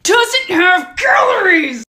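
slow, loud, high exasperated yell; slow disbelieving shout. Acted. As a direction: spell it out at the top of your lungs. Teaser shout at customer.

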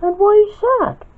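small, very high falsetto; light falsetto questioner; Peach. A narrator's reading. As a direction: ask in a high little character voice. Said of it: "asked Peach".

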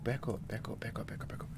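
soft, hurried whispering; urgent whisper. Real, not acted. Player creeping away from a threat, tense whispered urging. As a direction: whisper it as if the thing could hear.

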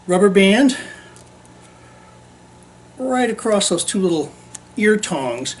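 usual speaking voice

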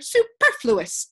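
slow, loud, high pronouncement; pompous official. A narrator's reading. Count echoing the other advisors, slow and pompous. Advisors' rapid chorus of refusals.

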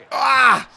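loud, high "ah!"; sudden high yell; shout of shock. Real, not acted. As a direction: cry out at the near goal. Near goal.

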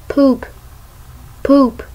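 ordinary delivery

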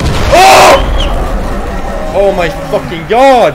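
loud terrified yell; screaming at a sudden scare; scared horror game streamer. Real, not acted.

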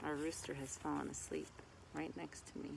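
usual speaking voice

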